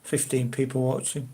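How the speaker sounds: usual speaking voice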